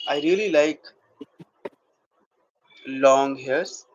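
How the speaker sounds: slow acting demo with long, weighted pauses; acted monologue. Shown as a demonstration. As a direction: act out a halting confession, letting each phrase hang. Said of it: "and doing acting".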